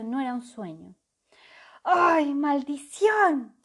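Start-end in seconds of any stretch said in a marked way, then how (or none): 1.8-3.5 loud, high, slow anguished cry; shout. A narrator's reading. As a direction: curse the botched spell. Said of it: "gritó el dragón".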